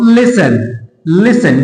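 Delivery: लिसन said slowly with t silent, lis-sun; correct pronunciation. Shown as a demonstration. Teacher drilling listen for students, slow. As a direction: draw out लिसन, no t at all. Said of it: "टी को silent कर दो".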